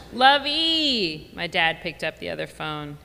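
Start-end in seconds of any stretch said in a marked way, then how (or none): 0.1-1.3 much higher, hearty call of a pet name; a father delighted to hear her; lightly voiced cheerful old dad; cheery dad greeting. Dad greeting daughter on phone.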